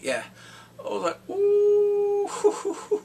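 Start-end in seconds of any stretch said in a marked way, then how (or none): 1.3-3.0 high, loud delighted ooh; laughing ooh. Real, not acted. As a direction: burst out in amused surprise. Reacting to Bran's reveal.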